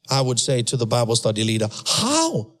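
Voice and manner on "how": high pleading how. Retold or imitated. His own past question retold.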